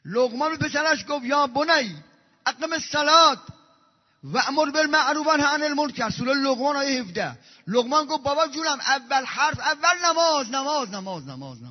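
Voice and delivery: loud, high impassioned preaching; preaching at full force. Acted. Preacher thundering to the congregation, loud and high. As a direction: pour it out from the pulpit.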